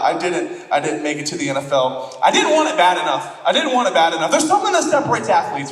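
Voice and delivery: ordinary talk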